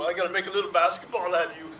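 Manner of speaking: ordinary talk, nothing marked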